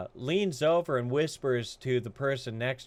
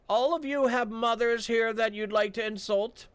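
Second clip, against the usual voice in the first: high, cold challenge from the guard; stern guard; 10 semitones higher than usual. Acted. Guard confronting the party after the insult, high and cold. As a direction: dare them to speak up.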